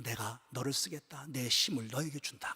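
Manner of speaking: slow, low and soft; gentle solemn divine promise; God's promise. Retold or imitated. God promising strength to the pastor, slow and soft. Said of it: "주님이 그러시더라고".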